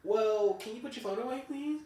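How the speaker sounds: a touch of a prim boss; mock polite boss. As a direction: ask it like a fake-nice manager. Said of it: "she goes".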